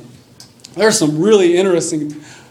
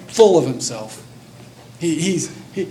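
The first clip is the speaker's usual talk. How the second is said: ordinary talk, nothing marked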